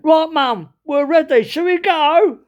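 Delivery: loud, high Cockney son. Retold or imitated. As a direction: call Mum to leave in broad East End. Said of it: "turned to the woman and he went".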